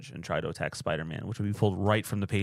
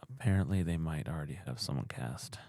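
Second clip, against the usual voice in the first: softer, let-down admission; disappointed; 5 semitones lower, 5 dB quieter than usual. Real, not acted. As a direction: say it softly, a little disappointed. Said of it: "like you were disappointed".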